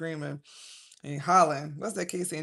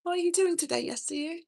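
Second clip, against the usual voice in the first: much higher, louder puppet voice greeting her son; high cartoon puppet asking a child about his day; 13 semitones higher, 5 dB louder than usual. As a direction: play the puppet, cooing at the child. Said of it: "I go".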